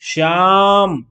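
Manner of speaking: a name called out loud to someone standing far off; calling from afar. Shown as a demonstration. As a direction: call the name across a distance, stretching the vowel. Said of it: "जैसे मैं आपको किसी दूर खड़े व्यक्ति को बुलाता हूँ".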